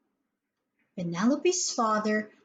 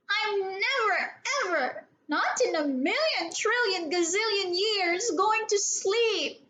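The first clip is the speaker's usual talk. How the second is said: put-on childish defiance; 8 semitones higher than usual. A narrator's reading. Defiant child refuses sleep.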